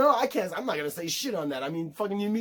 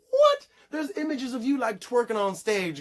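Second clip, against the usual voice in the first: high loud incredulous "what?"; shocked disbelief; 8 semitones higher, 3 dB louder than usual. Retold or imitated. Retelling reaction to reading [name]'s claim, shocked disbelief. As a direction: blurt it high and loud, stunned.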